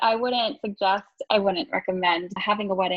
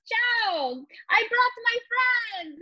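high, drawn-out singsong announcement; mock jubilant greeting; 13 semitones higher, much slower than usual. Returning customer greeting the waitress with friends, mock jubilant. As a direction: imitate a guest arriving in triumph, hammed up. Husband's cheery arrival, acted out.

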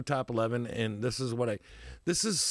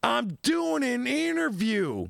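annoyed interviewee; 9 semitones higher, slower than usual. Retold or imitated. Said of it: "And he goes".

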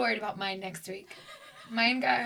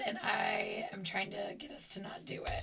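whisper; 2 semitones lower, 10 dB quieter than usual. Real, not acted.

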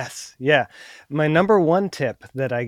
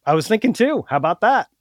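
higher, upbeat haggling intonation; friendly positive counteroffer; 5 semitones higher than usual. Shown as a demonstration. Buyer countering a seller's price, friendly and higher. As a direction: float the offer lightly, pitched up and positive. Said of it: "I like your voice intonation there".

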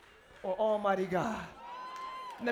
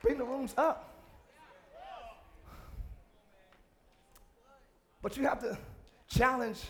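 calm reflective sermon; 6 semitones lower, 12 dB quieter than usual. Acted. Quieter reflective teaching.

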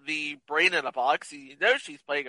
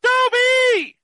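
very high, loud drawn-out holler; hammed-up high yell; comic name holler; 6 dB louder than usual. Calls out a name as punchline.

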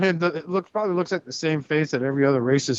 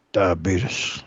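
put-on deep drawn-out old man; low, slow ad read; Wilford Brimley voice; 9 semitones lower than usual. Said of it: "Walter Brimley".

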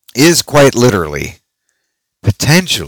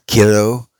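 normal talking voice

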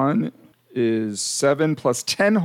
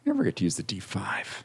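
whisper; 5 semitones lower, 9 dB quieter than usual. Real, not acted. Whispered aside.

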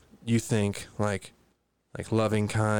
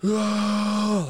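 long high held cry; imitated child's wail; 11 semitones higher, 3 dB louder than usual. Imitating a four-year-old in the back seat, high wail. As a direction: mimic a kid's wordless noise. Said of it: "making noises that you could tell".

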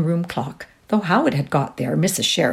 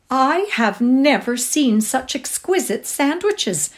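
higher, slowed, rapturous praise; 9 semitones higher, 4 dB louder, slower than usual. A narrator's reading. Josie gushing to cook about her sandwiches, rapturous. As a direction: gush over the sandwiches. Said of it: "said Josie's rapturous voice".

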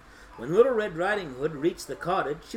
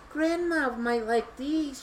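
put-on higher, slow little-girl voice; little girl's thought; 8 semitones higher, slower than usual. A narrator's reading. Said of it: "she thought".

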